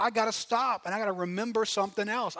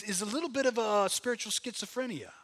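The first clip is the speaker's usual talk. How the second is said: ordinary talk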